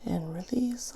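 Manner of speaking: soft whispered breathing cues; guided breathing. Acted. Coach guides listener through breaths, whispered.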